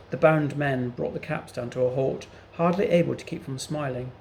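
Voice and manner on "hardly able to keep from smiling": ordinary delivery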